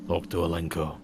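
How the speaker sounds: low, soft, empty voice; flat soulless voice. Acted. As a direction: speak with no life in it. Soulless barkeeper speaks.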